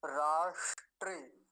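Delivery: राष्ट्रे said slowly, ष crisp; slow recitation; slow demo. Shown as a demonstration. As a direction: stretch राष्ट्रे out. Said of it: "अगला शब्द है राष्ट्रे".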